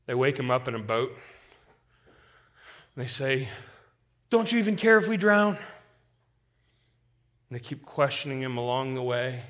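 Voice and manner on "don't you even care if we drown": panicked plea. Acted. Disciples cry out to Jesus.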